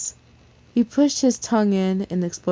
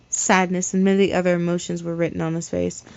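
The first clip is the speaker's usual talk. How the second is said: ordinary delivery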